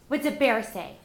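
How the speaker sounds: ordinary talk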